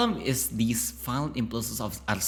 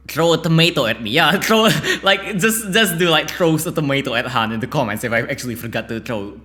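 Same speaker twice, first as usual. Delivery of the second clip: raised, higher-pitched playful urging, laugh breaking in; loud joking appeal; 6 semitones higher, 9 dB louder than usual. Real, not acted. Speaker invites commenters to pelt them, loud amused urging. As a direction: urge them on loudly, amused.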